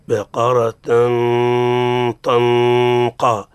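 "طنقا" with t thickened to ط and a long heavy hum; exaggerated heavy tanween. Shown as a demonstration. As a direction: overdo the nasal in "بقرة طنقا", dragged and jarring. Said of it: "يبالغون في التنوين ح-، حتى يق-، تقول فيه نشاز".

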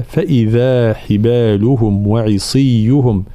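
slow recitation with full kasra vowels; تحقيق حركات الكسر; clear kasra vowels. Shown as a demonstration. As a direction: recite slowly, the kasras fully voiced. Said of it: "تحقيق حركات الكسر".

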